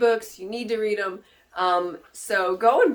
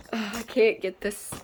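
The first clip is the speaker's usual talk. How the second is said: usual speaking voice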